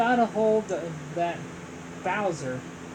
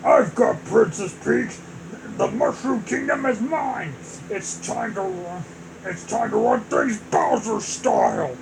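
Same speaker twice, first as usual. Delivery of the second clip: villain voice. Acted. Bowser gloats as villain.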